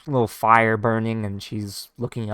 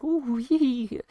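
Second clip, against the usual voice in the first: flirty giggle; 14 semitones higher, slower than usual. Retold or imitated. Bar ladies flirting at swordsman.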